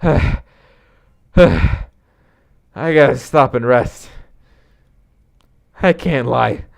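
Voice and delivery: lightly voiced worn-out buddy, losing heart; winded hot dog lover. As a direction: pause for breath between halting phrases, discouraged.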